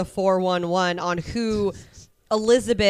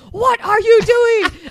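much higher, louder, slow mock shock; shocked question; 12 semitones higher, 7 dB louder, much slower than usual. Friend voicing Chessie spotting the Speedo, shocked. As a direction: stretch it out in disbelief. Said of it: "she's like".

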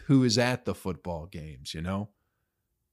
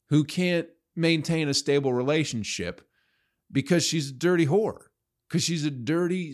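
raised, heated rant; 5 semitones higher than usual. Real, not acted. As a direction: spit it out in disgust, pitch up. Rant against a pop star.

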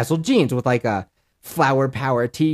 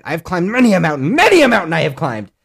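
mock-heroic boast; 4 semitones higher, 5 dB louder than usual.

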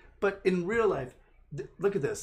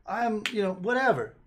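mock partisan zealot; 6 semitones higher, slower than usual. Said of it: "anybody that's so ridiculous".

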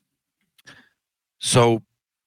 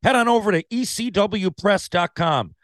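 upbeat promo read; bright sponsor plug, high; 9 semitones higher than usual. Acted. Podcast ad read.

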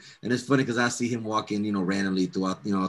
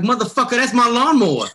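high, loud indignant yell; outraged shout; 13 semitones higher, 9 dB louder than usual. Retold or imitated. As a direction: shout it at the thief who stole it. Recognizing his stolen lawnmower.

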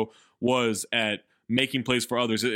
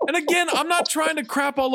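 indignant outburst; 13 semitones higher, 3 dB louder than usual. Real, not acted. Indignant reply about the air ball.

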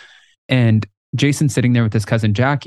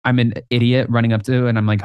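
ordinary talk, nothing marked

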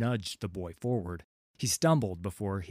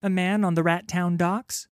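put-on high, nervous boy voice; a scared lad blurting his news; frightened urchin; 9 semitones higher, 9 dB louder than usual. Urchin reporting to the pirate captain, high and nervous.

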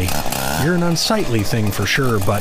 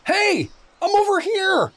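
calling out. Acted. Doug calling out.

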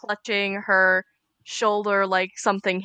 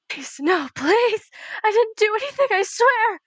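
put-on high, desperate begging in a kidnapped girl's voice; terrified pleading; 10 semitones higher than usual. Medium reliving a kidnapping begs her captor in terror. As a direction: plead for your life, voice climbing high. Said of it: "starts reliving the moment of kidnapping".

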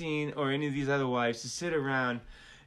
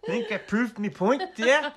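light Fargo accent with a laugh; 5 semitones higher than usual. Shown as a demonstration. Joking to cohost in a light Fargo accent. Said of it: "That's, that's my Fargo accent".

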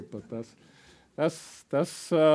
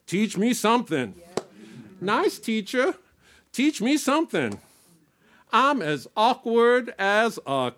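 high, theatrical, half-sung delivery; performed comic lyric; 10 semitones higher, 5 dB louder, slower than usual. Acted. Performer playing an awkward dance pupil pleading with the teacher, high hammed-up singsong. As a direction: ham up the clumsy pupil begging the teacher.

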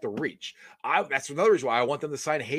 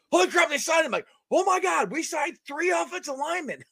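rising, shouted glee; excited; 11 semitones higher than usual. Retold or imitated. To his co-host about the signings, an excited shout. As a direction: relive the thrill of the signing.

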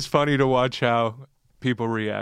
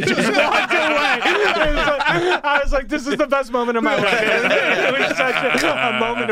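much higher, laughing, then a fast gush; ecstatic laughing; 13 semitones higher, 4 dB louder than usual. Retold or imitated. Fan recalling a celebrity handshake, laughing with joy. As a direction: giggle then blurt out the joy. Retells joyful celebrity moment.

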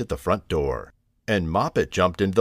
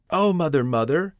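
high, lightly voiced kitten cry; alarmed kitten; 8 semitones higher than usual. Mittens, found in the jar, calls mother, mildly alarmed. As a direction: call for mother with mild alarm.

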